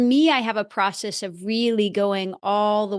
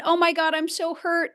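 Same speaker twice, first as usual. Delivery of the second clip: put-on hurt inner child; 7 semitones higher than usual.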